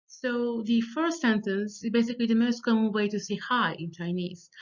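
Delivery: ordinary delivery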